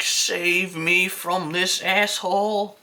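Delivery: slow, high pleading voice; mock desperate cry for help; desperate plea. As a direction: beg to be rescued, drawn out. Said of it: "he's just like".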